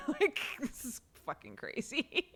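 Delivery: normal talking voice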